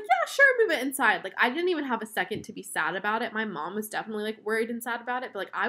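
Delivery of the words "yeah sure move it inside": excited. Retold or imitated. Retelling adrenaline-fuelled reaction.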